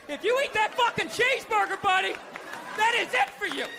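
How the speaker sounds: hammed-up clerical ultimatum; threatening priest. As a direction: warn him like an outraged priest. Priest threatening over a cheeseburger.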